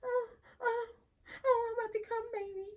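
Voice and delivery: mock fake orgasm. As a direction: overplay a phony climax to flatter him. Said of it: "giving him this fake ass orgasm".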